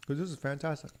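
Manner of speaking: talking through a mouthful of candy; chewing while talking, mouth full. Real, not acted. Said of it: "I'm gonna keep talking with my mouth full".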